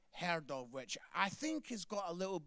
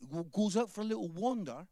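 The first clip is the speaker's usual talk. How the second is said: ordinary talk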